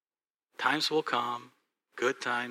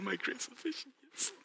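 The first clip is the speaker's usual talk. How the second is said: mock quote; 12 semitones higher, 10 dB quieter than usual. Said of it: "And she just said".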